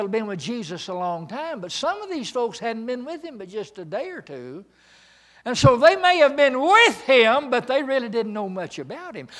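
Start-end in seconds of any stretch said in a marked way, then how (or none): 5.4-9.3 loud, high, fervent preaching; preaching at full force. Acted. Preacher rousing the congregation, loud and high. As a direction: drive it home to the congregation.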